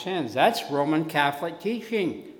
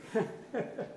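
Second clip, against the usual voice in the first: soft laugh; 9 semitones higher, 6 dB quieter than usual. Real, not acted. Laughing at his own joke.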